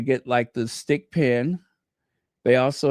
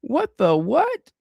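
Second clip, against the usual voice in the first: high, drawn-out mock disbelief; retold astonishment; 11 semitones higher, 3 dB louder, slower than usual. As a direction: replay your baffled reaction, pitched high and slow. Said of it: "I'm like".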